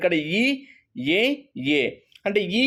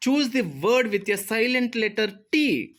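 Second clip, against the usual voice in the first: slowly reading the question out; 5 semitones higher, much slower than usual. Shown as a demonstration. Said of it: "reads question aloud". (ప్రశ్నను నెమ్మదిగా చదవడం)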